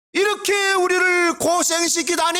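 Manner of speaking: high, slow shouted complaint as the mother; mother's complaint. Retold or imitated. Mother scolding her lost son, high slow shout. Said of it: "말도 안 하고".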